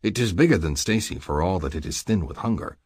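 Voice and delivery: plain reading voice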